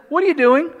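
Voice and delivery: put-on parent catching kid. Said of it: "the parent says".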